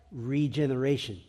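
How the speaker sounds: normal talking voice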